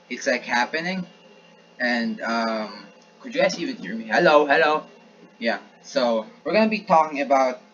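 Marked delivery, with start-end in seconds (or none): 4.1-4.8 calling out. Real, not acted. Mic check, calling to listeners.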